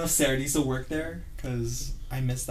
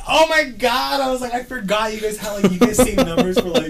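high, loud embarrassed oh my god; caught-out panic; 12 semitones higher, 9 dB louder than usual. Retold or imitated. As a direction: realize you've been caught ordering.

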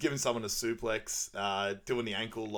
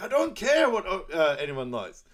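a hint of an exasperated Shrek, louder; Shrek snapping back; 9 semitones higher, 7 dB louder than usual. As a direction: brush it off like a fed-up ogre.

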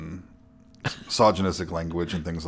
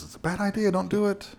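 much higher-pitched imitation of a lawyer; warning lawyer; 12 semitones higher, 3 dB quieter than usual. Lawyer advises client against talking to Megan, high-pitched warning. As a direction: pitch it up high to warn him off.

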